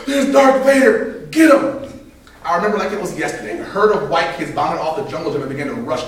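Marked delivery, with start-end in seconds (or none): 0.1-1.8 loud high yell, slow on Darth Vader; yelling kid. Retold or imitated. Bobby spotting the villain on the playground, shouting. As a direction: scream the alarm across the playground. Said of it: "yelled at the top of his".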